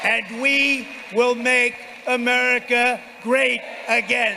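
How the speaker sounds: slow, loud, high final slogan; roaring rallying speech. Real, not acted. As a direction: draw out the big finish.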